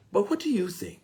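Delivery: asking gently, lightly voiced high; mature person asking. Preacher voicing a mature adult asking the listener, lightly high. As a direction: offer the question, a hint of an open listener. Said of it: "they never stop and say".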